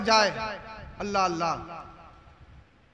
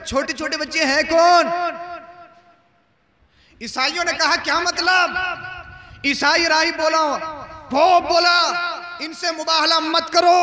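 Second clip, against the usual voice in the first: high, ringing preacher's delivery; impassioned preaching; 6 semitones higher, 6 dB louder than usual. Acted. Preacher retelling the pope's warning, impassioned preaching. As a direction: declaim each quote to the crowd.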